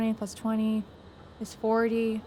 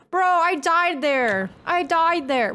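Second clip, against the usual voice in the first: high, loud, whiny complaint; exasperated gamer whine; frustrated; 6 semitones higher, 4 dB louder, slower than usual. Real, not acted. Frustrated complaint at game death.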